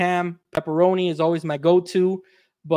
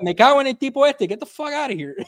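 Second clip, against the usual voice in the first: exasperated cursing; 6 semitones higher, faster than usual. Retold or imitated. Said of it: "I'm like".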